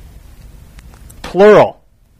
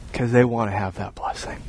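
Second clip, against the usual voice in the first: hushed, breathy whisper; 8 semitones lower, 13 dB quieter than usual. Real, not acted. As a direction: drop to a whisper for the blessing. Pastor drops to a whisper.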